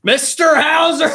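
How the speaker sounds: high, shouted announcer call; big game-show reveal, pitched well up; excited winner announcement. Real, not acted. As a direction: shout the winner's name out to the room. Host announces a tied winner.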